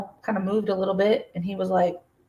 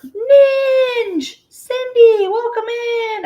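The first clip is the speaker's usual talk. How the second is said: high, bright calling out of names; squealing hello to chat; excited greeting; 7 dB louder, much slower than usual. Real, not acted. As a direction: sing out a welcome to the newcomers. Greeting viewers arriving on stream.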